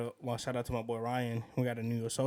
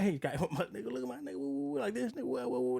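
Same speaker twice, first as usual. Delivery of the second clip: high-pitched, hammed-up hyping; playful mock greeting; 8 semitones higher than usual. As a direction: shoot the voice up high, goofing around. Acts out a casual, playful greeting.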